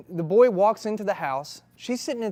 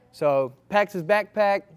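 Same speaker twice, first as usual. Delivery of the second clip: ordinary talk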